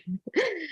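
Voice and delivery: a short high laugh through tears; relieved laugh after crying; tearful laugh. Real, not acted.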